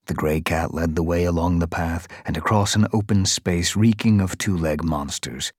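plain reading voice

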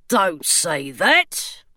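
a stone gargoyle gently objecting. A narrator's reading.

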